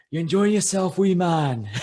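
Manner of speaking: high, loud Scottish singer's greeting; broad Scottish accent. Retold or imitated. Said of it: "thick Scottish accent".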